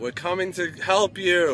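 calling out. Pretend. Calling out to the lost pet.

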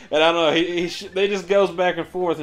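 ordinary talk

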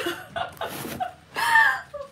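bright burst of high laughter. Real, not acted. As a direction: let a high laugh break out. Laughing at her own remark.

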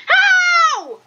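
very high, drawn-out cry; exasperated high shriek; incredulous yell. Real, not acted. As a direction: wail the word in disbelief. Disbelief at being killed.

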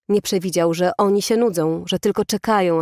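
usual speaking voice

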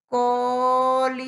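COLIBRÍ loud, split into long held syllables; drawn-out demonstration. Shown as a demonstration. Teacher asking a child to listen well, COLIBRÍ loudly stretched. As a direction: sustain the I sounds in COLIBRÍ. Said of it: "A ver, escúchame bien".

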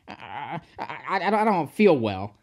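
hesitant, stammered start; quavering. A narrator's reading. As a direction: feign illness with a quavering stammer. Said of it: "quavered Sir Handel".

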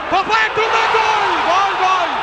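shouted goal call; screaming football commentator. Real, not acted. Second goal called live.

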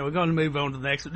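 quick mock British accent; imitation British accent, tossed off. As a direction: put on a hurried British voice. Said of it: "That's a British accent".

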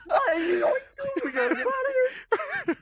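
high, laughing cartoon stoner voice; Shaggy voice. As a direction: do a giggly Shaggy impression. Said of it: "you sounded like Shaggy from Scooby-Doo".

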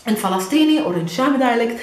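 ordinary delivery

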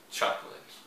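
chocolate whispered, English CHOK-lit. Shown as a demonstration. Teacher whispering chocolate to the students. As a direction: breathe chocolate without voice. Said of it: "não é chocolate".

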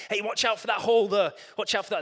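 loud, sharp warning call; warning shout. Acted. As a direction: call out the danger loudly. Jesus coaching, warning of hole.

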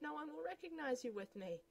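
ordinary talk

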